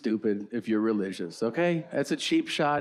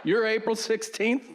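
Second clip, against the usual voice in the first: high excited clerk; 9 semitones higher than usual. Retold or imitated. Hotel clerk excited about birthdays.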